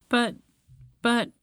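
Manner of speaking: halting, mildly tearful objection; hesitant, upset little protest. Acted. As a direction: falter between words as if near tears. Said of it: "argued with tears in his eyes".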